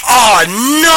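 high, drawn-out retold "oh no"; mock dismay. Host scrolling through future guests, high mock dismay. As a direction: act out a regretful realisation, pitch swooping up. Acts out own dismayed realisation.

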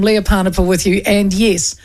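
ordinary delivery

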